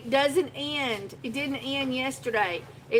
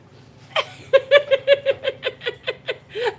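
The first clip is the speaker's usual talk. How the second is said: shrill rising laughter, much higher; high giggle fit; 10 dB louder than usual. Real, not acted. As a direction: let a giggle take over. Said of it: "I needed that giggle".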